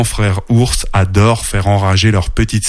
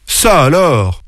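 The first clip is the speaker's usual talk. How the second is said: raised pitch, wondering surprise; astonished exclamation; 6 semitones higher, slower than usual. A narrator's reading. Father bear waking to a tidy house, astonished. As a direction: react amazed to an unexpected sight. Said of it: "marmonna-t-il".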